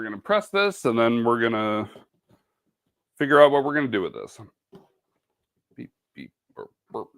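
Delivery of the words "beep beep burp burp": quiet beep imitation. Imitating machine noises.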